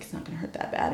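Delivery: hushed whispered reassurance. Retold or imitated. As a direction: whisper it like a coaxing boyfriend. Same hushed reassurance retold.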